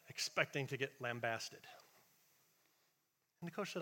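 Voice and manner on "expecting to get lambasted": normal talking voice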